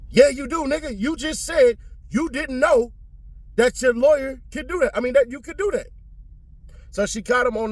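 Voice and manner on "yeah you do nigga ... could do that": high-pitched, incredulous call-out; indignant comeback. Pretend. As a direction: argue back, voice pitched way up. Argues back at the witness.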